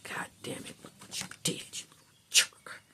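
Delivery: hushed unvoiced scolding; whispered curse. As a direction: whisper it under your breath to yourself. People muttering to themselves.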